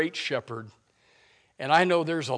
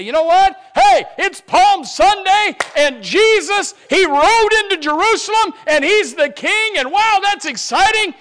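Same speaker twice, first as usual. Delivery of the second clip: much higher, much louder gushing voice; mocking shallow churchgoer; 16 dB louder than usual. Preacher mocks a shallow believer, high gushing voice. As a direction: gush like a shallow fan of Palm Sunday. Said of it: "that we would say".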